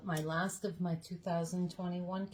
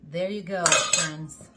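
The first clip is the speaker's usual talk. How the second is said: cheerful loud presentation; 10 dB louder, slower than usual. Real, not acted. Presenting finished food to viewers.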